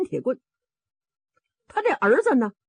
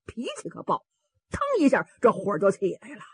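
usual speaking voice

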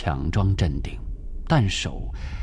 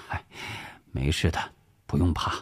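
low, soft reassurance; reassuring; 5 semitones lower, 5 dB quieter, faster than usual. Acted. Husband calming his worried wife, low and reassuring. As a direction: gently play down the worry.

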